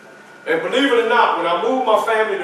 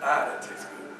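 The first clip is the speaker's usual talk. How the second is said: soft savoring murmur; 7 semitones lower, 13 dB quieter than usual. Acted. Savoring breakfast.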